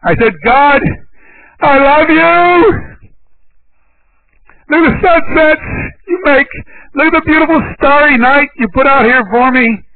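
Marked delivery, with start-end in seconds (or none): 0.4-3.0 high, drawn-out cry up to heaven; re-enacted joyful holler to God. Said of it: "as loud as I could holler". 4.7-9.8 high-pitched, exultant calling out to God; re-enacted holler up at the night sky; hollering praise. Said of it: "as loud as I could holler".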